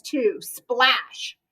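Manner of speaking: plain reading voice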